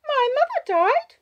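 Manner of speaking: put-on high, loud child's surprise; surprised child. A narrator's reading. Child hearing it as news, high surprised voice. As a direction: say it as if it were news to you. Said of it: "She sounds surprised".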